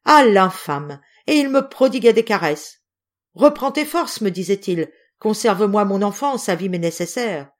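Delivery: loud, high-pitched impassioned denunciation; desperate, raging indignation; furious outrage. A narrator's reading. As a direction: defend your child with full fury. Mother rages at husband threatening her child.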